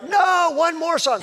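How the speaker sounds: high, drawn-out mock plea; high-voiced pleading crowd, retold; imitated crowd protest. Voices crowd begging for more.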